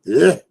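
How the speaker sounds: loud grunt of disgust; groaning in disgust at garlic; disgusted ugh. Real, not acted. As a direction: spit out the ugh like it tastes bad.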